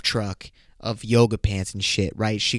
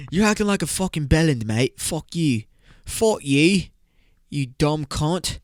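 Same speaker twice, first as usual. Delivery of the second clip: hammed-up British swearing; mock British lad telling someone off; mock British accent; 4 semitones higher than usual. Host demonstrates bellend as an insult, hammed-up British accent. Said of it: "some U K slang".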